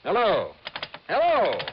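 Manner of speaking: calling out. Acted. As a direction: shout into a dead line. Calling into dead phone line.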